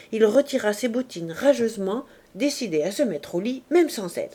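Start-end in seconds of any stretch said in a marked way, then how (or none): none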